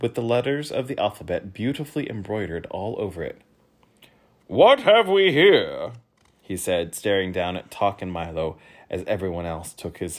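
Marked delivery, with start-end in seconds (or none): 4.5-5.9 slow, loud, higher royal voice; pompous king; booming king. Acted. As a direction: peer down at the boy. King speaks.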